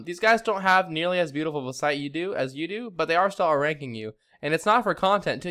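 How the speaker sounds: normal talking voice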